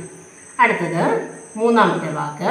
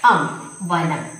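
spelled out slowly; 2 semitones lower, much slower than usual. Shown as a demonstration. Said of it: "ഒന്നും കൂടെ എഴുതി കാണിക്കാം".

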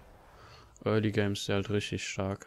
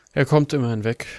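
excited; 4 semitones higher, 11 dB louder than usual. Real, not acted. Relief after escape.

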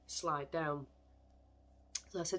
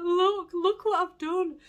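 much higher, much louder, slow wail of despair; retold distraught shout; 14 semitones higher, 12 dB louder, slower than usual. Showing her partner the dropped stitches, a high slow distraught shout. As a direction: cry it out like your knitting is ruined. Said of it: "when he heard me shouting".

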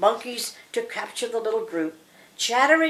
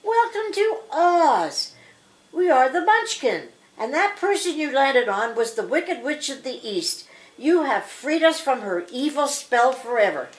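high, grateful storybook Munchkin; happy Munchkins; 5 semitones higher, 3 dB louder than usual. Acted. Happy little people welcome Dorothy to Oz, grateful and high. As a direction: raise the pitch for a joyful welcome.

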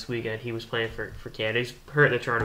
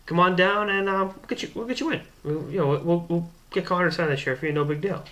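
higher, breezy promise; voicing the general manager; 6 semitones higher than usual. General manager promising the autograph, high and casual. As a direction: toss it off like it's nothing. GM Sherry voiced in story.

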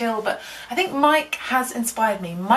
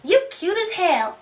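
high flirty voice; 7 semitones higher than usual. Pretend.

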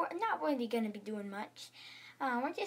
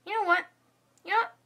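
higher, louder sudden decision; fed-up teacher; 6 semitones higher, 6 dB louder, much faster than usual. Acted. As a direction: burst out with a new idea. Fed-up teacher abruptly cancels school.

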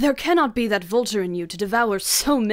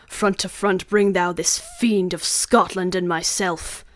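building to a much louder cry for revenge; vengeful vow; 7 dB louder than usual. Acted. Macduff vows revenge on Macbeth, building to a furious shout. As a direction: swear vengeance, rising to a roar. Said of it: "This tune goes, Manly".

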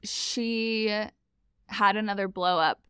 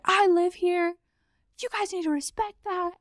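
mock roommate; 10 semitones higher than usual. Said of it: "And she's like".

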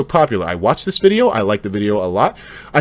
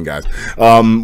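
ordinary talk

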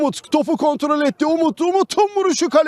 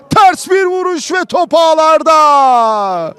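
screaming football commentator; 6 dB louder, slower than usual. Real, not acted. Ball hits the net.

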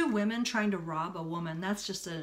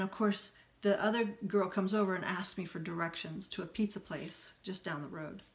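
ordinary talk, nothing marked

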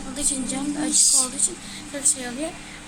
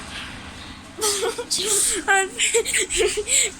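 burst of laughter; 7 semitones higher than usual. Real, not acted. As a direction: crack up after the scare. After scaring the aunt.

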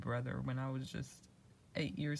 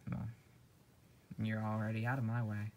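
ordinary delivery

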